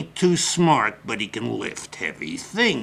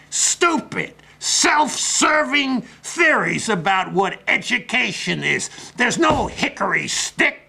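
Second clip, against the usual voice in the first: indignant rant; 7 semitones higher, 4 dB louder than usual. Acted. Indignant rant about liberals.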